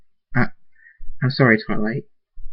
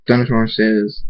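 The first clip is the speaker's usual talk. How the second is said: usual speaking voice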